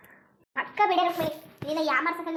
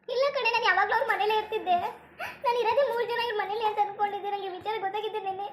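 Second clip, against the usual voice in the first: talking through acted sobs; tearful; 3 semitones higher than usual. Woman confessing she cannot live without him, tearful and despairing. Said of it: "ಹಿಂಗೆ ಕಣ್ಣೀರು ಸುರಿಸ್ಕೊ ಕೂತ್ಕತಿಯಲ್ಲ".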